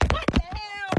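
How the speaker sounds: high, incredulous; shocked what the hell. Acted. Hearing her date can't drive, high shocked disbelief. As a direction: jump up in disbelief. Reacting to his confession.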